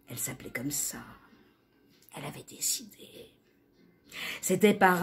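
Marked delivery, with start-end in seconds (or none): 0.1-3.3 breath-only, very soft storytelling; whispered narration. A narrator's reading. Narrator confiding her chosen name, whispered.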